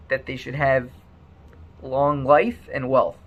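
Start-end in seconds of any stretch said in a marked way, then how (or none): none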